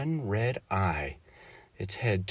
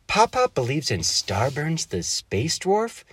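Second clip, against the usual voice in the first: high, slow shocked question; girl stunned at the news; 7 semitones higher, 3 dB louder, slower than usual. A narrator's reading. Daughter to father, high and slow with shock. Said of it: "A shocked look stretched across her face".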